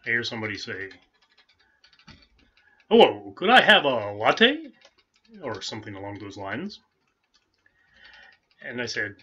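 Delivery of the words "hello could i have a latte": loud, slow, higher radio announcer voice ordering a latte; Paul Harvey-like voice. Retold or imitated. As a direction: boom it out like an old radio newsman. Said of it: "That reminds me of Paul Harvey".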